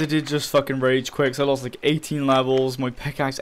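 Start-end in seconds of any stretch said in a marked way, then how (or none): none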